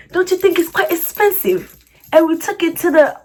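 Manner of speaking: amateur UK accent, building to high indignation; UK accent attempt. Shown as a demonstration. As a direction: put on an over-the-top London accent. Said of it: "let me do my best U K accent".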